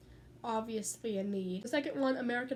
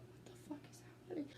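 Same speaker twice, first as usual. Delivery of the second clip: fast, voiceless mock whisper; whispered disbelief; 4 semitones higher, 17 dB quieter, much faster than usual. Friends at the table watching the costumed diner, baffled whisper. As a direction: hiss it under your breath to friends.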